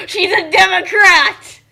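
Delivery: loud, slow gasp of horror then the news; gasping mock shock. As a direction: play it like scandalous gossip.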